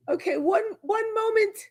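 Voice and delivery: high, prim English "one moment"; English accent. Pretend. To the visitor at the door, a high English-accented "one moment". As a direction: call out politely to the door like an English hostess. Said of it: "I done gave you three accents".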